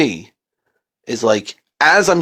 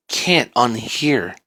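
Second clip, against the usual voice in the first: ordinary delivery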